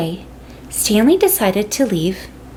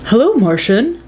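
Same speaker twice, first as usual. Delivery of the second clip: dad voice; 4 dB louder than usual. A narrator's reading. Dad greets the Martian.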